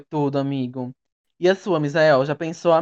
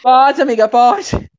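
emphatic go-ahead; 6 semitones higher, 7 dB louder, much slower than usual. Real, not acted. Urging friend to speak.

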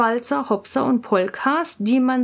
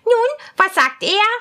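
much higher, louder impatient princess; 8 semitones higher, 6 dB louder than usual. Acted. Princess asks her lady, high and impatient. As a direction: demand the answer, high and curious. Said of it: "fragte die Prinzessin".

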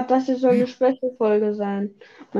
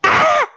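high mock scream, louder; imitating a scream; 5 dB louder than usual. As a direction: copy the enemy's shriek in a high voice. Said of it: "Soll ich die mal nachmachen?".

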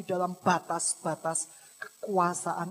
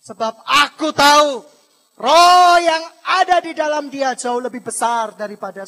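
loud, forceful voice of God; booming pulpit proclamation; thundering declaration; 4 semitones higher, 16 dB louder than usual. Acted. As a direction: proclaim it at full force to the devil. God's speech to devil continues loudly.

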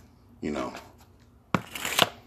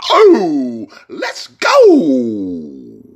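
excited cry; 5 semitones higher, 5 dB louder, much slower than usual. Real, not acted. Hyped cheer after rant.

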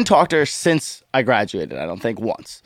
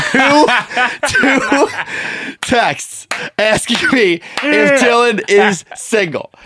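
laughing; 9 semitones higher, much slower than usual. Real, not acted. Laughing while telling the story.

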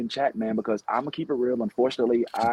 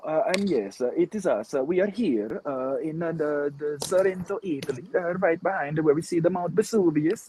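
higher-pitched, hammed-up Italian accent; mock Italian accent; 5 semitones higher than usual. Host pretends to report from Sorrento, Italy, mock Italian accent. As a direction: put on a shaky Italian accent that drifts toward Jamaican. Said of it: "sounding like an Italian Jamaican".

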